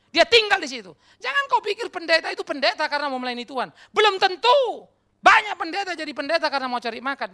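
high, loud preaching at full force; fiery preaching. Real, not acted. Preacher warning the congregation about false motives, high and loud preaching. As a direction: hammer home the warning.

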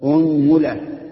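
أنملة as "unmula", u on hamza and meem; first of three u-initial forms, unmula for أنملة; u then u. Shown as a demonstration. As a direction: say أنملة firmly as UN-mu-la. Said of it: "ضم الهمزة".

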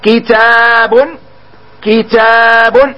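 كتاب drawn out very slowly; slow model word. Shown as a demonstration. Teacher introducing the next example, very slow drawn-out word. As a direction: stretch كتاب so pupils hear each sound. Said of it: "مثال آخر".

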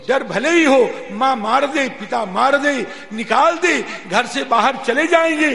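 loud, high, mounting declarations; children's defiant plea. Acted. Children swearing to brave parents' beatings for Ram, loud defiant plea. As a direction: hammer out each phrase at full voice. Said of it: "बालकों ने कहा कि".

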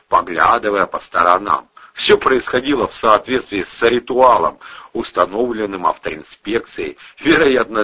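ordinary delivery

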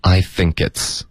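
slow "I think it's" with the k carried into "it's"; linked reading. Shown as a demonstration. Teacher repeating the linking demo, slow and joined. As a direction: join "think it's" without a gap. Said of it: "跟it's这一段我们得连读".

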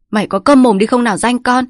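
hét to, cao giọng; quát cho con im bặt; gào lên. A narrator's reading. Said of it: "Quất gào lên". (bố gào lên bắt con câm)

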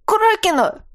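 high squeaky cry; squeak. Acted. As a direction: squeak it out in panic. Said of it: "пискнул кукольник".

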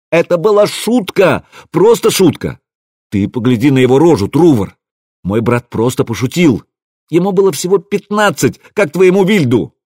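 high, indignant outburst; heated protest. A narrator's reading. Rasmus defends his fifteen-year-old brother to Truvor, heated protest. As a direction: protest hotly, pitch jumping up.

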